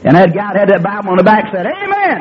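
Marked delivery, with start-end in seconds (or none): 1.7-2.2 high, soft imitated amen; a thin, higher amen from the pew; mock amen. Churchgoer answering the preacher, high soft amen.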